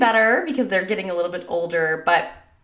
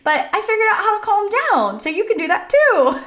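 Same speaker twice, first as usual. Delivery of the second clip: higher, lightly voiced encouraging kid; imitating young son; 9 semitones higher than usual. Son showing sister how to calm down, mildly childlike encouragement. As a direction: build to an upbeat, high-pitched child's pep talk. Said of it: "And he said".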